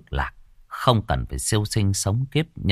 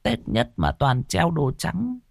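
put-on puzzled old woman; 8 semitones higher than usual. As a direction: ask like a confused old lady just woken. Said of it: "bà cụ hỏi".

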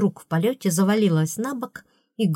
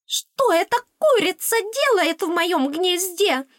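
very high, drawn-out squealing outrage; piercing squeal; 12 semitones higher than usual. A narrator's reading. Fairy finding a hen in her nest, piercing indignant squeal. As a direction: squeal it slowly at the top of your voice. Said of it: "пронзительный визгливый вопль".

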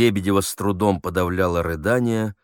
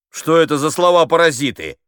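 high, loud scolding question; exasperated director's reprimand; indignant interruption; 9 semitones higher, 8 dB louder than usual. Acted. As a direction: cut in, pulling the actor up sharply.

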